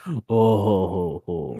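gasp, then a slow gloating villain laugh; Dio laugh. Acted. Hearing someone's day went badly, mock villain laugh. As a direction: laugh in spaced-out "oh ho ho"s, dropping away. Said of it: "that Dio laugh".

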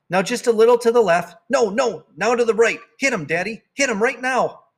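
put-on high, eager child calling out directions; excited calling. Girl shouting directions at dad chasing the spider, excited. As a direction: cheer him on to hit it. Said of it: "I called".

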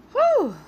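loud, high exhale of relief; breathy whew. Real, not acted. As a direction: blow out a big whew. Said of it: "I am out of breath".

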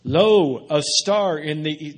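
mock-grand Bible pageant announcement; hammed-up herald, loud on the first word; pageant player. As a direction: proclaim it loudly like a staged nativity cue. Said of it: "they come out and say".

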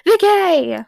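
high, loud mock yell; imitating the yell. Fan imitating Jeremy's yell at Vicky. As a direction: belt the name like the actor. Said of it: "instead of".

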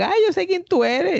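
high, sing-song gotcha; mock-innocent girl, pitched well above his own; smug girl. The other date calls him out.

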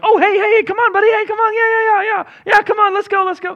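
high, loud, overeager coaxing; suddenly chummy crowd urging him along; fake friendly crowd. Crowd now fawning over Bartimaeus, high eager coaxing. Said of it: "all of a sudden they're like".

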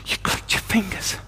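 hushed, voiceless whisper of wonder; amazed whisper. Retold or imitated. Healed man answering the one who prayed, awed whisper. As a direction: whisper it as if stunned.

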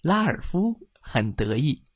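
plain reading voice

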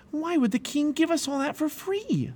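very high, incredulous question; amazed. A narrator's reading. Pliable amazed at the king's free gifts, incredulous. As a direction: ask in high-pitched disbelief. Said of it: "Pliable was amazed".

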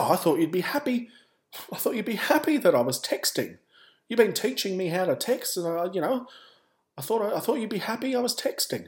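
high-pitched imitation of a defensive mother; protesting mum. Mum defending her texting to her son, protesting.

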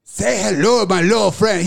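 lightly imitated bad Puerto Rican accent; a hint of hammy Puerto Rican movie accent. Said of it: "He's doing the bad fucking Puerto Rican accent".

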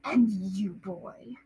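low, soft menacing uncle; Uncle Vernon. Acted. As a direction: growl down at the boy. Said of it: "if you hear me being low".

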